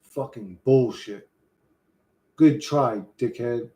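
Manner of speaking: put-on a streamer's lightly done Australian accent, low and drawn out; Australian accent attempt. Streamer practicing Australian accent for chat, mild Australian accent. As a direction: try out an Aussie accent, not quite there. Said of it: "I thought I sounded exactly like them".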